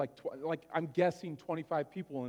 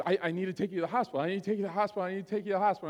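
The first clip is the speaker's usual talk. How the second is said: panicked mom; much faster than usual. Retold or imitated. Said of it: "And she goes".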